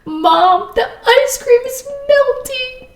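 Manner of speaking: high-pitched, drawn-out mock child's wail; sobbing daughter. Crying daughter to mom over melting ice cream, mock wail. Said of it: "My daughter screaming her head off".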